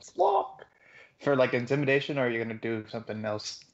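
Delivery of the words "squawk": very high bird squawk; majestic squawk. Acted. Player acting the bird on its turn, high majestic squawk. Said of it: "I'll make a majestic squawk".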